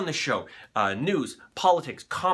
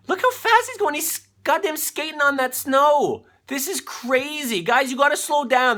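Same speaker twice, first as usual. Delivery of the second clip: excited disbelief; 9 semitones higher than usual. Real, not acted. Host reacting to replay.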